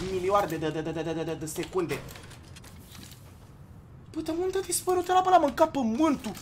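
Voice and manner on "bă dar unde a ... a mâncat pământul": high-pitched bewilderment without shouting; baffled disbelief. Real, not acted. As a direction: wonder aloud in a raised pitch. Baffled by vanished opponent. (nedumerire, cu voce înaltă)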